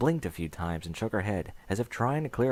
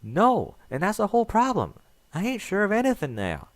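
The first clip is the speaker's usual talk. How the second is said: much higher, louder, frustrated; exasperated Applejack; 11 semitones higher, 4 dB louder than usual. Acted. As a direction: throw up your hooves in despair.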